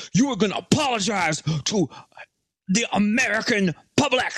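lightly hammed-up bully ordering him; tough guy demand. Tough guy threatening Fauci.

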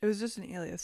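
usual speaking voice

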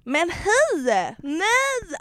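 a hammed-up Stockholm greeting, high and bright; mock Stockholm accent. Mimicking her Tinder date's Stockholm accent on the phone, high and mocking. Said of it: "han har sån stockholmska".

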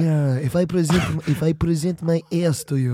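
put-on deadpan request in a thick Austrian accent; Arnold Schwarzenegger. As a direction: ask earnestly as the action star. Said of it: "Arnold, I can't".

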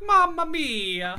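slow, stretched mock Italian exclamation. Cohost reacts to the Italian guy, mock Italian accent. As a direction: ham up a cartoon Italian. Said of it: "Exakt så sa jag".

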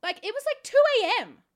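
loud, high protest; exasperated shouting. Retold or imitated. Guest insisting to staff it is not made up, exasperated shouting. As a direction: plead your case at top volume, incredulous. Retold argument with disbelieving staff.

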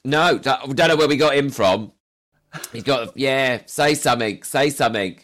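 belted out as a comic impression of family; hammed-up family boasting. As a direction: play relatives loudly showing off their odd child. Acting out family showing him off.

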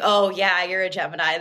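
usual speaking voice

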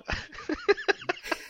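breathless squeaky laughter; helpless high giggle fit; high wheezing laugh. Real, not acted. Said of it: "He's just laughing at me".

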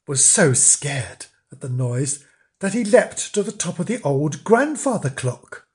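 ordinary delivery

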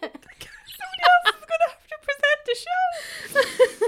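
very high, slow squeal of real laughter; real high-pitched laughing, words drawn out; helpless laughter. Real, not acted. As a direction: struggle to speak through a laughing fit. Helpless laughing fit at the start.

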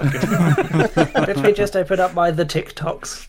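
put-on slow, laughing old boomer voice; grumbling out of touch boomer. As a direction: play a confused boomer, slow. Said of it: "[name]'s boomer voice".

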